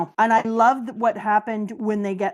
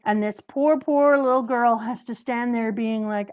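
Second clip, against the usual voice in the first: ordinary talk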